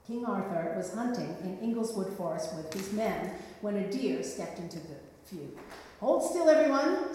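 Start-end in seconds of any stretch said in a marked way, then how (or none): 6.0-7.1 loud, higher royal order to his men; king's command. Acted. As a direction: call the hunters to halt. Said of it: "said the king".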